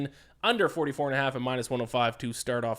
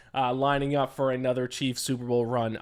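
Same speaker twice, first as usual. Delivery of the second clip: ordinary talk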